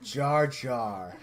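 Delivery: low, soft mock-drunk reveal; acted boozy murmur; drunken whiskey drinker. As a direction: say the name low and woozy after a last swig. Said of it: "takes the last drink of his whiskey".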